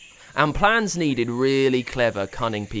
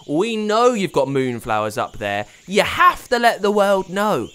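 put-on louder, firm young boy; insistent boy; 8 semitones higher, 7 dB louder than usual. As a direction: press her firmly like a kid. Pablo insists.

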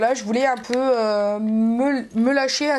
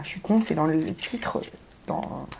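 "C'est dans" trails into a whisper, much softer; self-mocking aside; 7 semitones lower, 6 dB quieter than usual. Real, not acted. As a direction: drop to a whisper at the end, embarrassed. (aparté chuchoté, un peu gêné)

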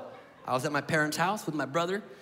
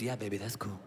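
much lower, softer laid-back voice; stoned dad; 11 semitones lower, 10 dB quieter than usual. Retold or imitated. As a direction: drop low and hazy like a stoned dad. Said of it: "He goes".

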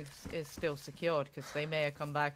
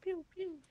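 gun noises; 4 dB quieter than usual. Pretend. Mimicking gunshots.

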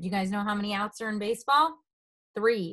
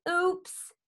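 high little "oops"; embarrassed cry; 7 semitones higher than usual. A narrator's reading. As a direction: let out a sheepish, high oops. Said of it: "cried Froggy".

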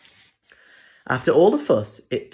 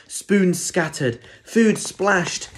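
ordinary delivery, nothing marked

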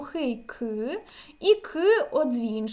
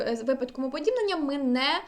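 ordinary talk, nothing marked

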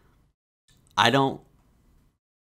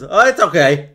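louder, breezy forced oh it's okay; forced reassurance; 3 semitones higher, 8 dB louder than usual. Retold or imitated. Grieving son answering condolences, forced bright brush-off. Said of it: "my only response is".

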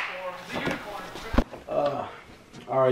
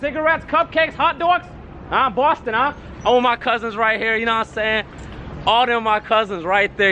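Boston hype guy; 6 semitones higher than usual. Acted. Boston hype chant character.

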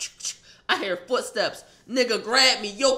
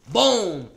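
punch sound; 8 dB louder than usual. Retold or imitated. Punching again.

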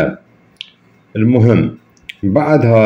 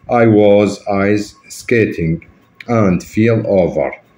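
slow, careful English for learners; slow model reading; much slower than usual. Shown as a demonstration. Teacher to students, showing how to pronounce: slow, deliberate English. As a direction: read it out slowly so students catch each word. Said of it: "نقراها قراية بالإنجليزي على مود أنت تتعلمها".

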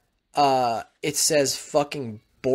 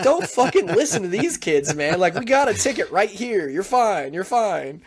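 urgent reassurance; 7 semitones higher than usual. Retold or imitated. Father reassuring his crying son, urgent. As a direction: talk a scared kid down fast.

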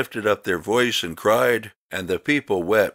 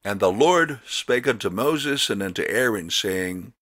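ordinary delivery

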